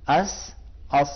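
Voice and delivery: slow heavy "اس", "اس"; thick sad. Shown as a demonstration. Teacher slowly demonstrating the thickened س. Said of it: "تو کس طرح موٹا کرے گا".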